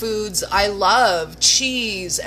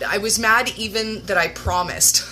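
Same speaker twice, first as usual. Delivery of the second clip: usual speaking voice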